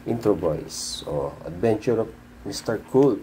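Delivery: ordinary talk, nothing marked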